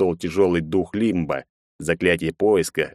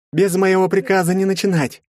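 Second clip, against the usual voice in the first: high, raised commanding voice; commanding order; 12 semitones higher, 4 dB louder than usual. A narrator's reading. Герцен forbids the others to start without him, commanding. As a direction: lay down the order firmly. Leader orders his men.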